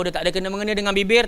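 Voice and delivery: ordinary talk, nothing marked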